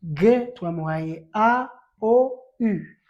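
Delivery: teaching aloud. Shown as a demonstration. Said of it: "teacher listing syllables".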